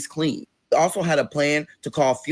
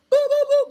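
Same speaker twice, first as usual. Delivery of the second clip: very high, loud booing; loud mocking boo; 4 dB louder than usual. Real, not acted. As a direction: boo her off the stage. Reacting to money request.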